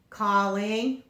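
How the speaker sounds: plain reading voice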